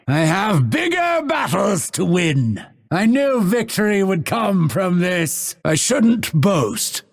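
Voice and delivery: high, exultant declaration; triumphant boasting. Acted. Fighter boasting after victory, exultant.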